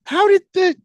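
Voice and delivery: high, loud, stammering bafflement; incredulous disbelief. Retold or imitated. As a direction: squawk in hammed-up disbelief at the result. Acts out baffled viewers' reaction.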